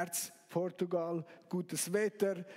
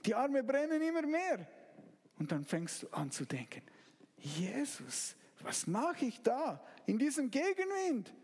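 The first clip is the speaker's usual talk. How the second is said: vivid sermon storytelling; 4 semitones higher than usual. Acted. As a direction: draw the listener into the boat, building tension. Immersive storm scene narration. (eindringliche, erzählende Predigtstimme)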